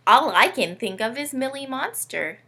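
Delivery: ordinary delivery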